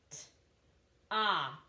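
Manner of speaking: "T-ah" with a gap after T; sounded out. Shown as a demonstration. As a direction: separate the T and long ah in "T-ah". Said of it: "I'm gonna sound out those words".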